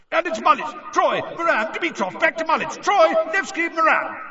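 high, loud, rapid commentary; live play-by-play. A narrator's reading. Bagman calling the passes at speed, excited commentary. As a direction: rattle off the names fast.

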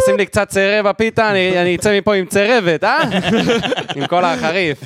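high, emphatic guttural customer; Israeli street accent. Pretend. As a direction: put on a heavy Mizrahi falafel-stand voice. Keeps falafel customer voice. (מבטא ישראלי כבד של לקוח בפלאפל)

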